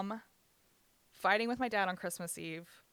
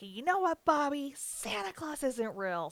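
higher-pitched imitation of a furious mother, slowing down; seething mom; 7 semitones higher, slower than usual. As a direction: retell her rage at moderate volume. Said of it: "she was, like, foaming at the mouth".

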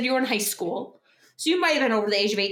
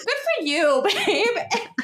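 proud laughing praise; 9 semitones higher, much slower than usual. Retold or imitated. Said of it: "I was like, I was like".

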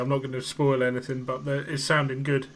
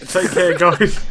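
loud; 4 semitones higher, 10 dB louder, slower than usual. Real, not acted. Cheery loud sign-off.

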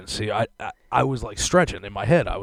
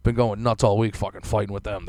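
ordinary talk

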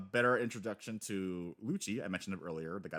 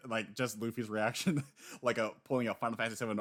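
ordinary talk

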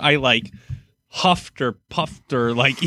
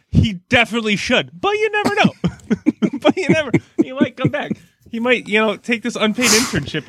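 much higher, wishful; mock hopeful; 8 semitones higher than usual. Fan dreaming the player returns, high hopeful voice. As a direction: float it up hopefully.

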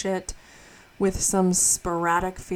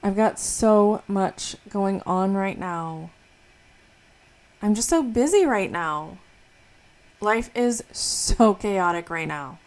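loud, whiny overwhelmed venting; overwhelmed complaining; 6 dB louder than usual. Acted. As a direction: moan about being swamped. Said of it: "saying something like this".

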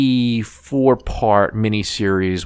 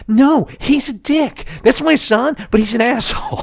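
mock shout; 12 semitones higher than usual. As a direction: act out wanting to shout down the nurse. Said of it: "I totally wanna shout".

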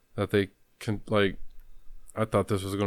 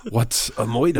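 put-on Fat Tony accent; 3 semitones higher, 4 dB louder than usual. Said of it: "like Fat Tony from The Simpsons".

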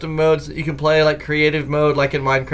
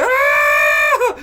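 high shrieking scream; mock little-girl scream; girly scream; 3 dB louder than usual. Scared of the giant wolf spider, high girly shriek. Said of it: "I screamed like a little girl".

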